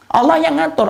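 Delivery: high, loud fervent call; high, ringing preacher's proclamation; fervent declaration. Real, not acted. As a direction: proclaim it to the congregation. Repeated emphatic declaration.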